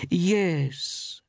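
high, drawn-out woman's yes; high voice, reaching a slow decision; deciding at last. A narrator's reading. Woman shopkeeper searches her memory, deciding at last. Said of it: "she decided at last".

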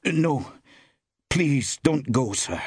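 pleading, high-pitched urgency; urgent. A narrator's reading. Young man stops a customer leaving, urgently. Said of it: "note of urgency in his voice".

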